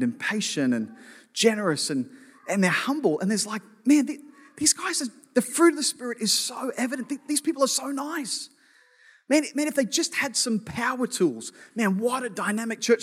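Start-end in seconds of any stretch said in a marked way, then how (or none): 3.8-13.0 higher-pitched, gushing churchgoer impression; imitated admiration. Preacher voicing visitors impressed by the fruit of the Spirit, mock admiration. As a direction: gush in a raised voice as an impressed visitor.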